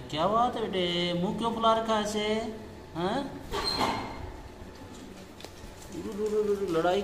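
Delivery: high, loud sing-song scolding of a pet; pet talk. Real, not acted. Owner telling the angry parrot off in high baby-talk.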